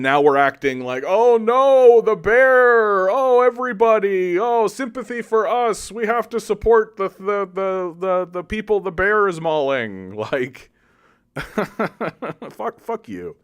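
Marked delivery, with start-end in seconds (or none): 1.1-10.2 high, loud whiny mock alarm; sarcastic high wailing about the bear; mock panic. Mocking Western self-pity. 10.2-13.4 laughing. Real, not acted. Laughs heard.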